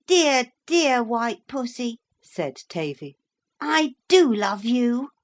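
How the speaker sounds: a hint of a doting young boy; fond child. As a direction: gush over the cat. Tavy adores cat.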